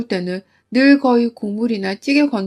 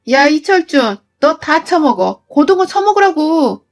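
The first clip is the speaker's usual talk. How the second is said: furious shout; 4 semitones higher, 3 dB louder than usual. Acted. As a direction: throw the words at him with the fish. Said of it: "하며 고등어를 손으로 집어서 집어 던졌습니다". (남편에게 고등어를 던지며 분노에 차 소리치듯)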